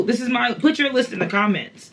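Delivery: ordinary delivery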